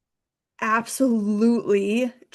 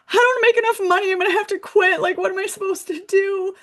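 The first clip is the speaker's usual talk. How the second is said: much higher, louder, fast, crying out; tearful wail; 12 semitones higher, 4 dB louder, faster than usual. Retold or imitated. Bursting out to colleagues after bawling in the lounge, high tearful wail. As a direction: blurt it all out through tears. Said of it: "I was like".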